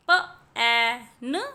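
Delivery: म ए न, each sound split apart and slow; sounding out letters. Shown as a demonstration. Teacher sounding out a word for children, slow and split. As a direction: stretch म ए न into separate sounds. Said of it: "तो यहाँ पर क एट".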